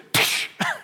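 a breathy guilty little laugh; sheepish chuckle. Shown as a demonstration. Preacher shows the right way to read the list, sheepish chuckle. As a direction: laugh like you've been caught. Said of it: "you go like this".

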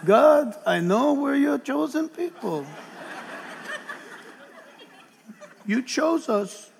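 soft, pleading character voice; Tevye. Acted. Chosen man begs God for a break, in Tevye's voice. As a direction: plead wearily with God, softly and with pauses. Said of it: "Remember Tevye and Fiddler on the Roof?".

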